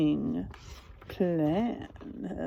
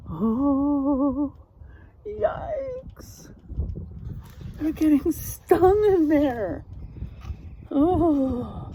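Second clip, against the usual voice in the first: mock alarm; 6 semitones higher, much slower than usual. Teacher reacting in mock alarm.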